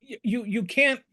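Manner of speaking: ordinary delivery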